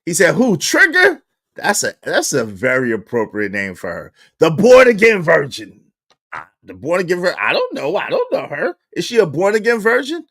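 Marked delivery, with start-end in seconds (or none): 0.3-1.2 squeaky mock disbelief; a mild high-pitched viewer voice; mock high quoted voice. Host quoting a viewer's baffled question, mock high. 4.4-5.8 loud mocking title. Real, not acted. Host mocking the woman as a born-again virgin, loud.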